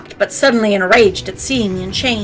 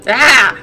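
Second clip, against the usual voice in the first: pained cry; 3 semitones higher, 5 dB louder than usual. Acted. Blinded by light.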